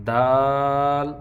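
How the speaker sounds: دال held slowly for three counts; long daal. Shown as a demonstration. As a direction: stretch the letter name دال. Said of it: "تین حرکت تک لمبا کریں گے".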